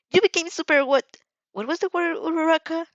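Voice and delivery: put-on high, loud, eager girlish chatter; a lightly voiced bubbly classmate; excited gushing.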